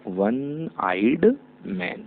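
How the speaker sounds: very slow, word by word; slow dictation. Shown as a demonstration. Teacher dictating an example sentence to students. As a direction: spell each word out for the class. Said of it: "teacher models sentence slowly".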